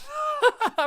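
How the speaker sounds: sudden high laugh; high burst of laughter. Real, not acted. Streamer cracks up at a miss, high laugh.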